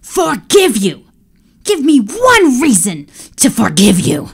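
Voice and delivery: raging emperor; furious. Acted. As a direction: hurl the words back. Emperor yelling at servant.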